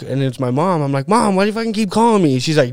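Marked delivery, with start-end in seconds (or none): 1.1-2.4 higher, fast annoyed snap at mom; fed up with her calling; irritated yell at mom. Retold or imitated. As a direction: son snapping at his mom on the phone, irritated. Annoyed son answers mom's call.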